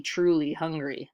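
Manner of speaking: plain reading voice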